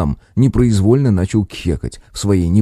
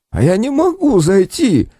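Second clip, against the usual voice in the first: much higher, slow plaintive whine; suffering; 13 semitones higher, slower than usual. Acted. Injured Kostik answers his colleagues, suffering. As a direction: sound pitiful and in pain. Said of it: "страдальчески ответил Костик".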